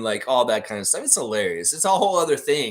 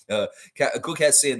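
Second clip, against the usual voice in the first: ordinary talk, nothing marked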